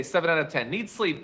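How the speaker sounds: normal talking voice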